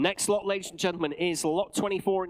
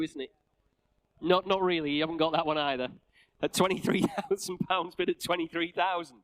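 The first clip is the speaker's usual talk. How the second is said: ordinary talk, nothing marked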